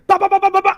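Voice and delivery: high rapid-fire "ba-ba-ba" punches; vocal sound effect of a flurry of blows; imitated punch flurry.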